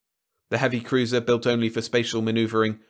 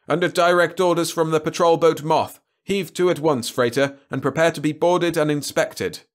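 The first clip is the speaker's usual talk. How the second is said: put-on raised patrol order in broad South Propendium accent; 7 semitones higher, 5 dB louder than usual. A narrator's reading. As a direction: official radio command with a South Propendium accent. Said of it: "now with a broad South Propendium accent".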